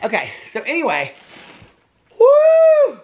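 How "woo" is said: breathless, high "woo"; big high woo after a run; high loud whoop. Real, not acted. Catching breath after running back in.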